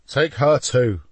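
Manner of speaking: usual speaking voice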